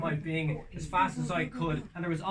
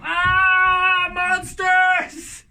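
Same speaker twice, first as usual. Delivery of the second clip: higher, loud fake panic; mock panicked cry; 16 dB louder, much slower than usual. Crowd screaming over the voice of truth. People drowning out the voice.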